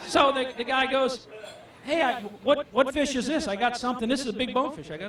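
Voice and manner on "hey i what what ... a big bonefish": higher-pitched retold excitement; excited client. Client hooks an unknown fish, puzzled excitement. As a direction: lightly act out an excited angler. Client exclaims over a surprise catch.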